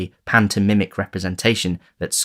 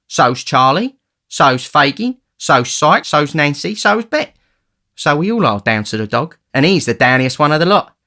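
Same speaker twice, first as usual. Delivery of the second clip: a hint of a boastful street boy, rising higher; lightly voiced cocky young thief; 7 semitones higher, 6 dB louder than usual. A narrator's reading. The Dodger boasting to Oliver, lightly voiced and cocky. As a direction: brag down the list of names, lightly.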